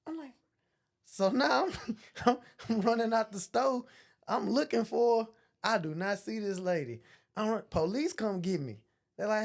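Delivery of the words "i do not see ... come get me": ordinary delivery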